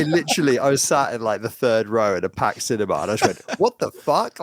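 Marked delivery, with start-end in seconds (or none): 3.6-4.4 high-pitched retold outburst of disbelief; startled, pitched-up exclamation; involuntary outburst. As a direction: blurt it out in shock. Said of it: "Like, involuntarily".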